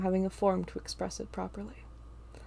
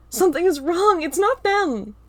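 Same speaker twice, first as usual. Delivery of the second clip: urgent shout; 10 semitones higher, 11 dB louder than usual. Acted. Urgent realization.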